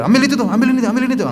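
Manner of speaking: high, curt mock commands; bossy orders. Wife ordering her husband around, a hammed-up bossy tone. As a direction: bark errands at him, mock bossy.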